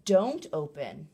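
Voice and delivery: put-on slow, loud, firm warning to a child; slow stressed warning to a child, clearly modelling the word; emphatic don't open. Shown as a demonstration. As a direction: lean hard on open, drawn out. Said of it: "Maybe we might say".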